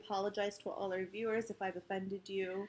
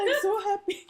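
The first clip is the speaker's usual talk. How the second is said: copied squeaky voice; 11 semitones higher, 5 dB louder than usual. Pretend. Copies friend's squeaky joke voice.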